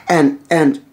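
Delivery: plain reading voice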